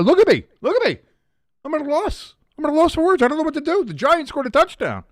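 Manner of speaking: high, loud flustered gushing; overwhelmed. Real, not acted. Streamer overwhelmed by the touchdown, high flustered joy. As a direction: stammer in joyful disbelief. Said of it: "I'm out of breath".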